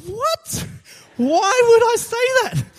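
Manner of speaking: very high-pitched mock disbelief; incredulous. Preacher questioning his own offer to the mugger, incredulous. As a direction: squeal it in comic astonishment at yourself.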